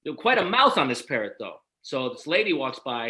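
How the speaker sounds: ordinary delivery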